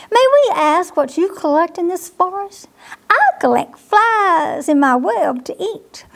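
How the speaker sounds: lightly voiced forest chat; spider voice. Papa and spider dialogue.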